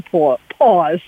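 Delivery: New York vowel slipping into 'pause'; 'pause' with a New York accent. Real, not acted. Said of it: "That's my New York accent coming through".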